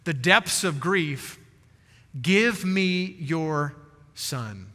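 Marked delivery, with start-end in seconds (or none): 2.2-4.7 very slow, trailing down to a hush on son; slow, tender plea. Retold or imitated. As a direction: hold each word, drop to almost nothing at the end. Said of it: "simply says to this woman".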